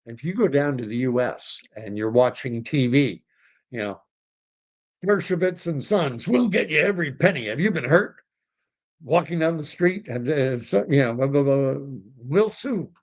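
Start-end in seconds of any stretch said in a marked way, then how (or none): 5.0-12.9 higher, pushy lawyer-ad pitch, fast in places; mock TV lawyer ad. Mocking US TV lawyer ads for the listener, pitched up and salesy. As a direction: ham up a sleazy injury-lawyer commercial.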